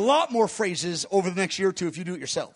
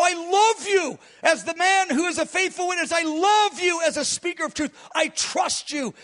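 higher, tender words of love to Jesus; tender prayer; 6 semitones higher than usual. Shown as a demonstration. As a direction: pour out love to him. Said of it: "I go".

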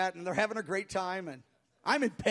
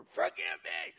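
high-pitched cry for forgiveness; imitated wailing; 12 semitones higher, 4 dB louder than usual. Pastor imitates the collapsed woman begging forgiveness, wailing. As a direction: mimic a woman wailing out in repentance. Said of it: "crying out that Jesus would forgive her".

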